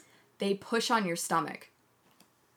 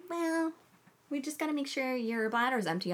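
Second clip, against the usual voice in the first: higher, softer prim nurse voice; mock nurse; 6 semitones higher, 6 dB quieter than usual. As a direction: play the patient nurse lightly. Nurse quoted.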